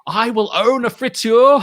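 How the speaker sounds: loud, high, slow mock vow; grand proclamation. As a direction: declare it slowly, high and loud. Grand declaration for laughs.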